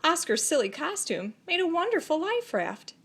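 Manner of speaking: plain reading voice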